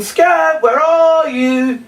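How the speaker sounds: high, loud calling for the lost dog. Shown as a demonstration. Entertainer calling out loudly for the hidden puppet dog. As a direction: shout his name as if he's hiding far off.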